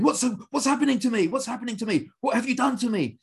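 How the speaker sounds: high, lightly voiced panicked cry; mock bewildered distress. Tough guy in class asking what's happening, mock distress. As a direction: act out grown men overwhelmed by emotion. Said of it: "and they're going".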